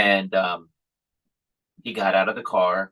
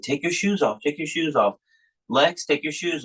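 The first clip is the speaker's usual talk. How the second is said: raised, higher urging; urging kids; 7 semitones higher than usual. Retold or imitated. Dad hurrying sleepy boys to take shoes off, urgent call.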